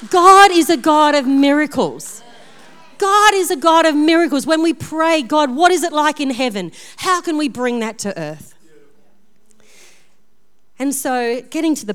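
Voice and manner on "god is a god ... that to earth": full-force preaching. Acted. Pastor proclaiming miracles to her congregation, loud preaching. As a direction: ring it out to the congregation.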